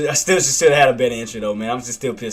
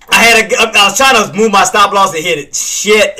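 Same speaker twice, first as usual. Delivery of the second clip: real frustrated outburst; 5 semitones higher, 6 dB louder than usual. Real, not acted. Exclaims about mistake.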